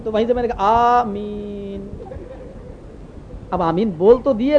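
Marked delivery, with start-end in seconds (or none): 0.6-2.0 long Ameen fading away softly; Ameen tailing off. Retold or imitated. Lone worshipper hears nobody joining in, Ameen fading out. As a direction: start the Ameen, then let it die down. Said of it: "کسی کی آواز نہیں آ رہی".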